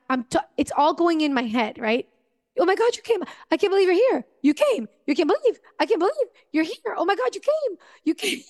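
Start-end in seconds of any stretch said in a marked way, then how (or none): 2.6-8.4 much higher, breathless with wonder; gasping at a miracle you begged for; overjoyed disbelief. Retold or imitated. To Jesus at her bedside, high overjoyed disbelief. Reliving awe at Jesus's arrival.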